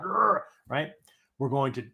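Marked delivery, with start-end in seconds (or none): 0.0-0.4 a short pitched-up growl of anger; loud growl. Shown as a demonstration. Teacher showing the loud angry state, growling. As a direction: growl like you're ready to fight. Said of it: "Or this loud".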